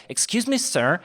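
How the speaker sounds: high, pushy tourist voice; a brash American journalist asking directions. Retold or imitated. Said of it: "she came up to me like".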